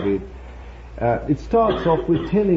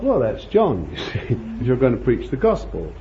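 ordinary talk, nothing marked

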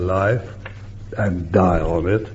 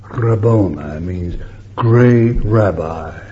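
usual speaking voice

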